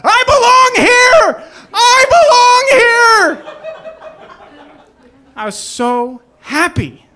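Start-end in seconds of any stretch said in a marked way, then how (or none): 0.0-3.5 much higher, louder, slow ecstatic yell; overjoyed cab driver shouting at the skyline; joyful shout. Retold or imitated. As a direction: shout it out the window at the city. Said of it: "I shouted out the windows".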